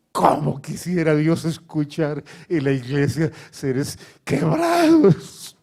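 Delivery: loud, slow, high preaching cry; impassioned preaching. Real, not acted. Preacher urges church to pray brokenhearted, in a loud impassioned cry. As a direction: cry it out, stretching the key words.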